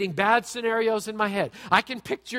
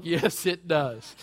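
slow, deadpan smug yes; slow smug answer from a listener; smug agreement; 2 semitones lower, much slower than usual. Retold or imitated. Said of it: "Some of you are like".

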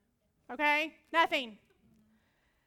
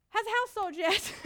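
sister's question; 7 semitones higher, 5 dB louder than usual. Retold or imitated. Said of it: "She says".

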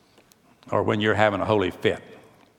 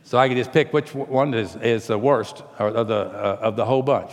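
ordinary talk, nothing marked

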